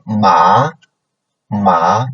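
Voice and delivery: MA rising from low to high; MA sliding upward; second tone. Shown as a demonstration. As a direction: lift the pitch steadily through MA. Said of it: "from a lower point to a higher point".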